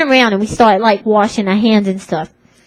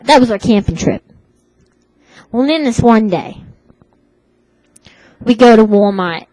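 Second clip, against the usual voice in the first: normal talking voice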